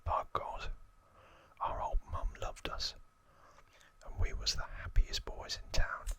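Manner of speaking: lightly acted Cockney gangster, whispering; Cockney accent. Gangster reminiscing about childhood with Archie, whispered Cockney accent. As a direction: whisper a put-on Cockney grumble. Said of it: "That's my best Cockney accent".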